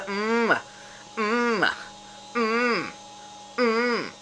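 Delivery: high-pitched falling "mm", repeated; gloating "mm". Real, not acted. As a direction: relish it with punchy hums. Savours the victory.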